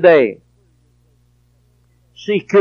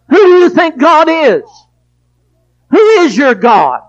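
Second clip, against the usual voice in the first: high, loud, ringing preacher's cry; full-force preaching; 10 semitones higher, 6 dB louder than usual. Acted. Preacher challenging the congregation about idols, full-force preaching. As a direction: thunder it at the congregation.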